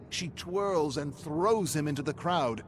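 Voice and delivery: ordinary delivery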